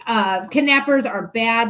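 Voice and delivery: normal talking voice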